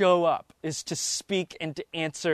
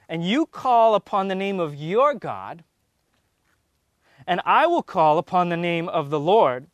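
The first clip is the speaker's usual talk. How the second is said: louder, measured Bible reading voice; scripture reading; 4 dB louder than usual. Acted. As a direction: read it out like a preacher at the lectern. Reads scripture aloud again.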